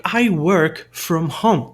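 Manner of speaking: very slow, clear I work from home; slow demo sentence. Shown as a demonstration. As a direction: spell the sentence out slowly for learners. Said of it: "Tem duas formas de você pronunciar essa palavra".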